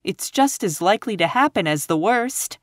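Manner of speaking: put-on high, earnest girlish plea; pleading. A narrator's reading. Anne pleading against Eliza's gloom, pleading. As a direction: urge it hopefully in a high voice. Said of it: "pleaded Anne".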